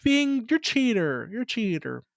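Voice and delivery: put-on drawn-out, high childish complaint; mock-whiny accusing kid. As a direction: whine it like a kid calling out Daddy. Mimics child accusing daddy.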